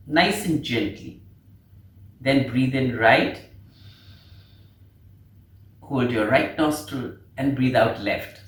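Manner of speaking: slow, low instructing voice with long pauses; guided breathing. Shown as a demonstration. Teacher guiding beginners through alternate nostril breathing, slow calm guidance. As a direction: lead them gently through each breath, unhurried.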